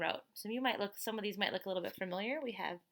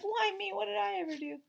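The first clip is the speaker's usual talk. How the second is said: much higher, whimpering toy voice; sad toy pig; 13 semitones higher, 4 dB louder than usual. Retold or imitated. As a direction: plead like a forlorn stuffed pig. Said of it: "Mister Pig he looks so sad".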